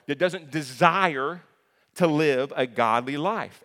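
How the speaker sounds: "desire" slowed and hammered out; preaching. Acted. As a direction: drive it home from the pulpit. Preaching climax.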